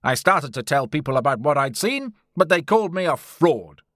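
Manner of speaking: loud bitterness, rising on the accusation; aggrieved, forceful character voice; bitter. A narrator's reading. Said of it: "he said bitterly".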